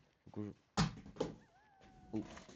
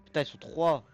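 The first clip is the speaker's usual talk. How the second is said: alarmed outburst; 7 semitones higher, 12 dB louder than usual. Real, not acted. Alarmed at three zombies.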